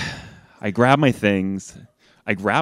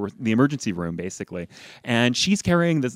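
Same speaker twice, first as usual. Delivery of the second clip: usual speaking voice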